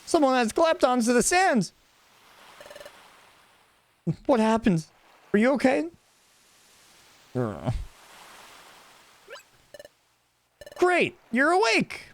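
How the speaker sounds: high, bright voiced Pokemon friend; cheerful partner voice. Elliot finds the stranger on the beach, high eager voice. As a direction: play the eager young helper, pitched high.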